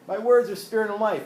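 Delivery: high and loud, words hurried out to stop them; urgent exclaimed quote. Retold or imitated. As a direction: call it after people who are leaving. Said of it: "Jesus kind of said".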